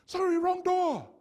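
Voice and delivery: put-on high, loud falsetto old lady apologising; sheepish old lady. Preacher imitating the old lady at his door, high sheepish falsetto. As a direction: squeak it out like a flustered little old lady. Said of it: "sheepishly says".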